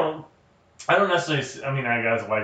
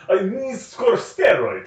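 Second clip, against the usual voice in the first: higher and slow, in broken-English mock accent; mock foreign accent; 7 semitones higher, slower than usual. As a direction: drag out each word, playing a foreigner with poor English. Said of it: "doesn't speak English that well".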